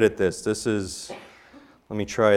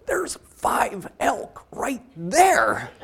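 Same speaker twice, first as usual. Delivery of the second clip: excited hushed report; 12 semitones higher, much slower than usual. Retold or imitated. Partner spots elk, hushed excitement.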